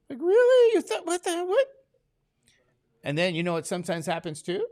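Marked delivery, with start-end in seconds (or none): 0.1-1.7 high, loud disbelieving really; incredulous. Real, not acted. Teacher scoffing at students' excuse, incredulous. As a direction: react in amazed disbelief. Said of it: "teacher reacting in disbelief".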